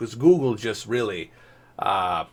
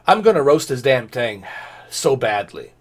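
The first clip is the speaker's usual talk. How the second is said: ordinary talk, nothing marked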